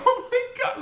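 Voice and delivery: high-pitched laughing gasp; giggling high oh my God; laughing squeal. Retold or imitated. Retold laughing fit.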